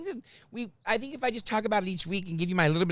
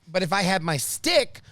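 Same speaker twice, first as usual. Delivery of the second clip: ordinary talk, nothing marked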